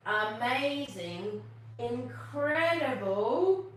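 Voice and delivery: building suspense before the punchline. Acted. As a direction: stretch each word to tease. Building up to the fart.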